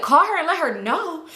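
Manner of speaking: high-pitched mock disbelief; incredulous retort. Aunt refusing to confess she lost kid, incredulous. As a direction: echo the suggestion as if it's absurd.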